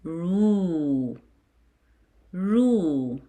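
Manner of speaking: "ru" as a long roo; "Ra", "Ri", "Ru" held as rah, ree, roo; vowel drill. Shown as a demonstration. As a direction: model each long syllable, pausing for pupils. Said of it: "You pronounce it as".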